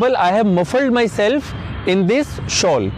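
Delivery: very slow, clear English phrase; slow model sentence. Shown as a demonstration. Teacher modelling a phrase for viewers, very slow. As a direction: say it slowly so they can repeat. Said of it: "For example".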